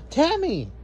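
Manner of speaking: high, bright cry of the name; cheering. A narrator's reading. Melissa greeting her friend Tammy, cheering. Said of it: "cheered Melissa".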